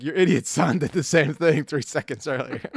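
loud mom voice breaking into laughter; imitating laughing mom. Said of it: "She's like".

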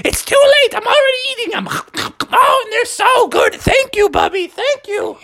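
excited Froggy with treats; high, delighted character voice; gleeful naughty frog. Acted. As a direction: gobble and gush gratefully.

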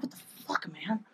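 a breathless whispered what the fuck; shocked whisper. Retold or imitated. To her friend pushing her at a stranger, stunned whisper.

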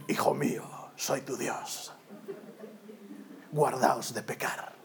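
deep, slow, solemn biblical God voice. Retold or imitated. Preacher mimicking God warning a young believer, deep solemn intoning. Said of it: "a Dios hablándonos en Reina Valera".

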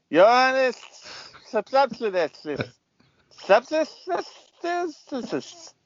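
high, drawn-out announcer with a lisp; weird PA announcer voice. Retold or imitated. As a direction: call the batter like a lisping stadium announcer. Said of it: "saying his last name all weird with a weird voice".

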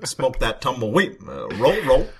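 halting mock-presidential voice with swooping pitch; Obama. President answering an interviewer's question, mock-statesmanlike delivery. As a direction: play a president answering in punchy, broken phrases. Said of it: "Mister Obama, what do you like doing more".

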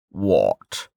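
low and very slow; solemn Westbridge. A narrator's reading. Westbridge announcing the moment, low and slow. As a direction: weigh every word.

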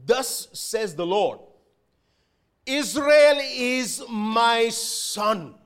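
very slow, loud declaration; solemn proclamation. Acted. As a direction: proclaim every word slowly. God's declaration to Pharaoh.

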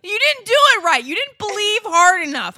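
high, loud, hammed-up blame; mock scolding. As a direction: scold at full volume, jokingly. Mock scolding over failed self-belief.

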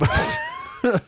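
very high-pitched burst of laughter; real laugh. Real, not acted. Host cracking up at the old-timer joke, high laugh.

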